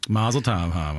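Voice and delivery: light mocking sarcasm; sarcastic. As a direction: tease her with the line. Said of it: "you had to do it sarcastically".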